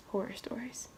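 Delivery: breathy spooky whisper of Horror stories. Shown as a demonstration. Closing the video, whispering Horror stories spookily.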